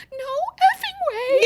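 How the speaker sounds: put-on high, slow, teary squeal of a little girl; a seven-year-old sobbing with joy over a puppy; crying in disbelief. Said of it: "like, crying and she's like".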